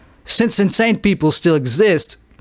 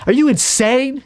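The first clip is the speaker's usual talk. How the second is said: incredulous question; 8 semitones higher than usual. Acted. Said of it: "that's what you asked".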